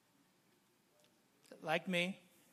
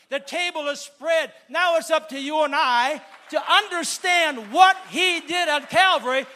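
loud, building sermon shout; full-force preaching; 6 semitones higher, 12 dB louder than usual. Real, not acted. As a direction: preach it to the rafters, slowing at the climax.

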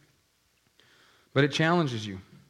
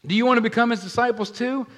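higher, louder, mocking "wanna"; sarcastic jab; 10 semitones higher, 6 dB louder than usual. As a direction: needle them with a sly taunt. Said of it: "he throws a juke on him".

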